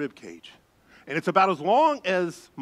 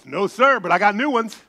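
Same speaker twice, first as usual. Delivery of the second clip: punchline reply; 5 semitones higher, 7 dB louder than usual. Retold or imitated.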